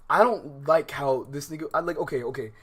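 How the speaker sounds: usual speaking voice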